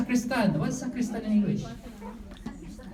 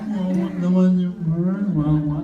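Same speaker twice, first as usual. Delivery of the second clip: "Kawawa naman" pushed louder through the nose with a laugh; mock nasal pity; 3 semitones lower, 7 dB louder than usual. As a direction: ham up the nasal sacristan pitying her. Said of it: "Ngongo din". (pabirong ngongong "Kawawa naman")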